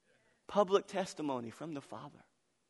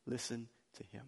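"Listen to him" repeated much softer; soft echo; 3 semitones lower, 11 dB quieter than usual. Shown as a demonstration. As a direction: let "Listen to him" fall to a hushed echo.

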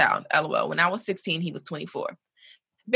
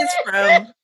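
excited shout; 6 dB louder than usual. Real, not acted. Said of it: "loud announcing laugh".

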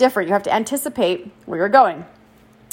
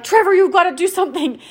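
panicked plea; 8 semitones higher than usual. Retold or imitated. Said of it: "I'm freaking out".

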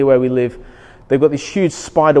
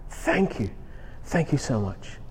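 much softer, as if in private prayer; acted quiet gratitude, voice dropped low in volume; hushed thanks; 3 semitones lower, 13 dB quieter than usual. Believer thanking God for catching them, hushed thanks.

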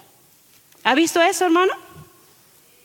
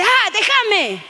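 niño enojado imitado; 7 semitones higher, much slower than usual. Retold or imitated. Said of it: "el niño dice".

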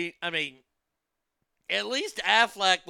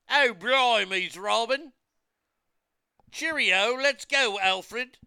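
mock British accent; 8 semitones higher, 6 dB louder, slower than usual. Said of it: "Give it up to a Brit".

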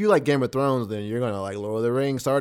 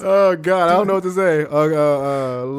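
laughing; 4 semitones higher, 7 dB louder than usual. Real, not acted. Laughing after failed Gollum voice.

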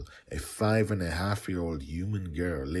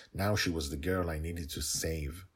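ordinary delivery

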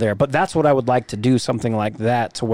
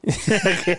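amused laugh; 6 semitones higher than usual. Real, not acted. Laughing at the football joke.

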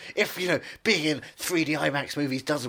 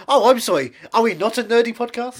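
mock outrage; 8 semitones higher, 11 dB louder than usual. Mock indignant outburst.

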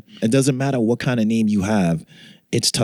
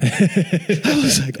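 high laughing burst; 10 semitones higher, much slower than usual. Real, not acted. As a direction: laugh through the words. Laughing about the melting heat.

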